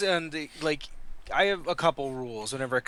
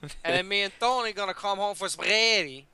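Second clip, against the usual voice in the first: put-on Italian accent; 3 semitones higher than usual. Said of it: "Italian [name]".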